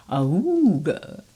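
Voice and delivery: loud braying bagpipe noise; a loud honk from the squeezed bagpipe. Acted. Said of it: "Did you honk or bray or neigh?".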